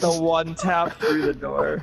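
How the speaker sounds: excited. Real, not acted. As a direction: marvel at the winning shot. Replaying the winning shot.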